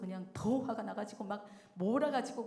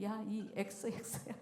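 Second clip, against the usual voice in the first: ordinary talk, nothing marked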